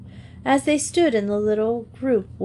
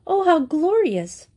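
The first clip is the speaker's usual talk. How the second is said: bright joyful outburst, pitched higher; joyful exclamation; 8 semitones higher, 3 dB louder than usual. A narrator's reading. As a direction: burst out with delight. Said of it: "as she said joyfully".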